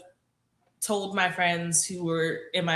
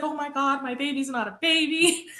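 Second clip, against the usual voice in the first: high-pitched imitation of tearful joy; mock happy crying; 6 semitones higher than usual. Mom hearing daughter is engaged, imitated happy crying. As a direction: play a mom overcome, lightly hammed-up. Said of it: "happy cries".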